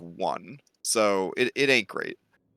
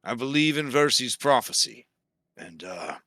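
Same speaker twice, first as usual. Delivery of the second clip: solemn Pythor; 3 semitones lower than usual. Acted. Pythor addressing the party about his people, solemn. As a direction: weigh each sentence with regret.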